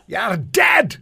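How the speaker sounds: high, explosive bellow; foghorn yell. Retold or imitated. Officer who always yelled at his trainees, foghorn bellow. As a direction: yell it out like a huge, loud petty officer. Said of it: "He always yelled".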